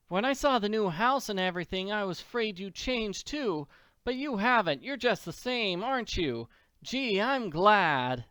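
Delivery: put-on relief that old friends stayed the same; relieved Annie. Annie tells the Silos she's glad they haven't changed.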